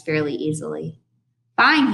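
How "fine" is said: high, loud angry outburst; screamed. A narrator's reading. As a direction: shout it as an exasperated director. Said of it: "he screamed".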